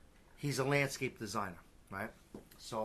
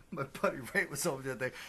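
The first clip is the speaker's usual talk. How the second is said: normal talking voice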